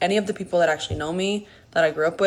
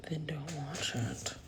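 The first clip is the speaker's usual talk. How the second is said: a dry whispered comeback; hushed, pointed aside to critics; whispered retort; 2 semitones lower, 16 dB quieter than usual. Real, not acted. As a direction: drop to a whisper and shut them down.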